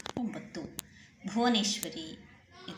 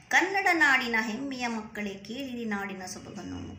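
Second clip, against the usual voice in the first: louder, rhythmic verse reading; poem recitation; 3 semitones higher, 5 dB louder than usual. Acted. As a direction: proclaim the line to the class. Re-reads a poem line before explaining.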